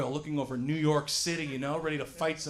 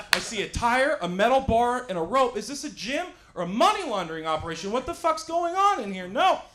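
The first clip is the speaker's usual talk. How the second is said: loud incredulous outburst, building higher; indignant rant; 6 semitones higher, 6 dB louder than usual. Acted. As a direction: build to an outraged, raised-voice refusal. Indignant rant about CrossFit gyms.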